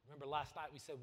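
normal talking voice

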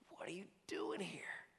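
breathy whispered question. Acted. God whispering to the hiding believer, soft whisper. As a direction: whisper it tenderly.

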